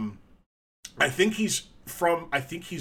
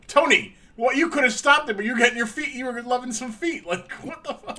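mock outraged; 10 semitones higher, 5 dB louder than usual. Mock scolding wrestling figure.

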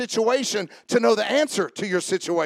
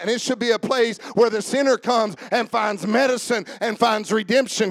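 ordinary talk, nothing marked

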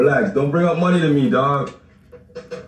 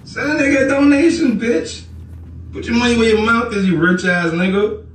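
much higher, heated jeer; taunting demand; 11 semitones higher than usual. Real, not acted. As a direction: goad them into paying. Goading viewer to donate.

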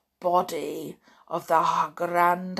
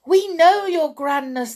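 much higher, loud cringing reply; timid chorus; 9 semitones higher, 10 dB louder than usual. A narrator's reading. Cowed witches answering their leader, high fearful chorus. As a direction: answer her in eager fear. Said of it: "they all answered".

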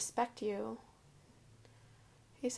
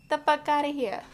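punchy, louder kiss-off line; loud catchphrase; 5 semitones higher, 9 dB louder than usual. Shown as a demonstration. Telling viewers what to say to a disloyal partner, loudly. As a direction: send them packing. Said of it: "tell them".

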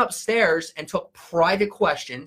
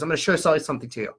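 fast, "show sell" fused into one word; quick imitation of a subliminal sales pitch; imitated slip-in; much faster than usual. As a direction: rattle it off, sliding "show sell" past the listener. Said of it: "That's how he said it".

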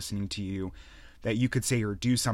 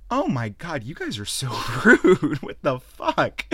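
mock offended, laughing; 5 semitones higher, 8 dB louder, slower than usual. Streamer mocking viewers who leave.

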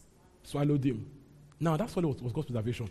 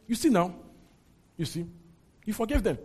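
high, accusing you see now; fuming Jonah; 4 semitones higher than usual. Acted. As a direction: jab the words at God. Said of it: "He was fuming".

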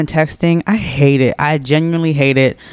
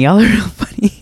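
laughing; 6 semitones higher than usual. Real, not acted. Laughing at viewer questions.

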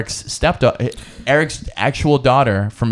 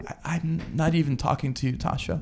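a much softer, icy refusal; cold quiet wife; 3 semitones higher, 11 dB quieter than usual. Retold or imitated. Wife snubbing the stepdaughter, in a cold quiet voice. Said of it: "she walks in and goes".

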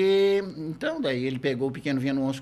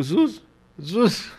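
slightly raised questioning repeat; mildly baffled father repeating the word; puzzled echo; 3 semitones higher, slower than usual. Retold or imitated. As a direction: echo it back, unsure. Father echoes stoned son's greeting.

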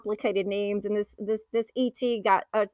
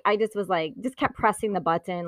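ordinary talk

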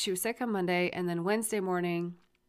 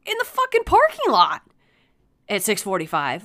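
exasperated shout; 9 dB louder than usual. Retold or imitated. Parent arguing with son about the pickup, exasperated shouting. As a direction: yell the answer back, then drop the pitch.

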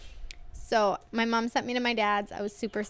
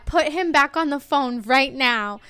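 furious mom; 3 semitones higher, 8 dB louder than usual. Retold or imitated. Mom ordering dad back on the phone, loud and outraged. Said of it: "And she was like".

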